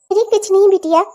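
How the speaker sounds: usual speaking voice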